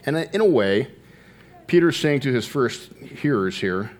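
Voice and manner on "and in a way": normal talking voice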